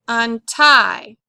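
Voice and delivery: "Untie" with a light Un and strong TIE; stress on root. Shown as a demonstration. Teacher showing accent on root word, "Untie" stressed on TIE. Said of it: "The accent's going to go on tie".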